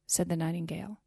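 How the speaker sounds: ordinary delivery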